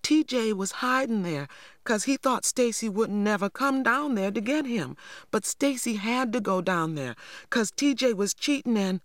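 high, urgent pleading explanation; frightened girl talking her way out, high; anxious rush. A narrator's reading. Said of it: "I cried anxiously".

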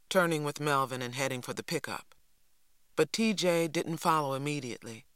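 ordinary delivery, nothing marked

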